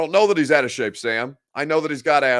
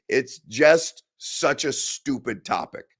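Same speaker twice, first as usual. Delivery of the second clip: usual speaking voice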